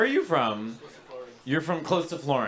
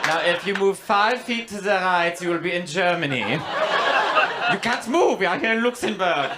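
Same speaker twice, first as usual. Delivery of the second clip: a lightly voiced proud, louder Luxembourger; hint of a self-important local boasting; mock Luxembourger; 3 semitones higher, 4 dB louder than usual. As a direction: play a pompous tiny-country local, lightly. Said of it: "they're like".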